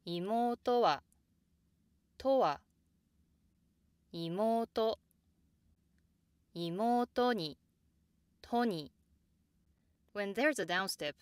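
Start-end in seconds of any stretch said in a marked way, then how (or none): 0.1-2.6 妹は high through と, dropping on は; downstep after word. Shown as a demonstration. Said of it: "hear the downstep only when something else follows". 4.1-5.0 妹 rises after the first sound and stays high; accent at the end. Shown as a demonstration. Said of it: "downstep only when something else follows". 6.6-8.9 妹に high through と, dropping on に; downstep after word. Shown as a demonstration. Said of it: "hear the downstep only when something else follows".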